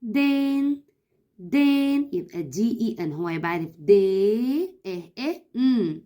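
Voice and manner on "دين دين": دين on its own, higher, like sounding out; one clear beat; syllable by syllable. Shown as a demonstration. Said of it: "هتقولي كده دين".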